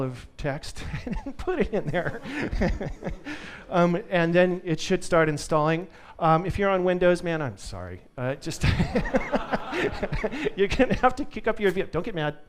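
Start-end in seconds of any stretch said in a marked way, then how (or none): 2.2-3.7 laughter. Real, not acted. Laughs at wall of text. 8.7-10.5 laughter. Real, not acted. Laughs apologizing to Windows users.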